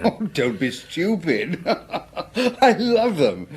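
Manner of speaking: laughing it off. Acted. Doctor laughing off the inspector's remark, amused laughter. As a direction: chuckle away the accusation.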